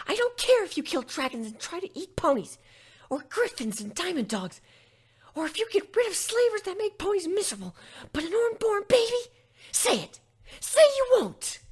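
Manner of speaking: a shy pony screaming her demand; furious demand. Acted. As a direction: force the promise out of her. Fluttershy demands a promise.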